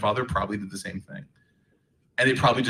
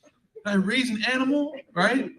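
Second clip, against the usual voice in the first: much higher hammed-up mother's lament; emotional Egyptian mom; 13 semitones higher than usual. Imitating mom wailing at son to get up. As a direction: melodramatic mock despair. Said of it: "Egyptians are very emotional".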